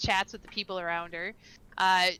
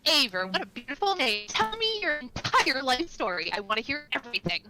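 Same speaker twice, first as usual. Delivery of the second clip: bubbly, raised voice of a sociable local; friendly chatty half-elf; 4 semitones higher, 4 dB louder than usual. Acted. Castry greets the new arrival at the bar, bubbly. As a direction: gush at the newcomer.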